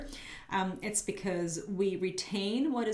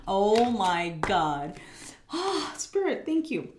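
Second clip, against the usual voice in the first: real thrill at what the cards show; delighted surprise; 5 dB louder, slower than usual. Real, not acted. Reader thrilled that the cards follow the message.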